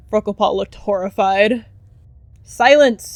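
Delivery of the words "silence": high, loud command; shouted silence. Acted. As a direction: bark it at the jeering crowd. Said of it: "he whipped around with a snap".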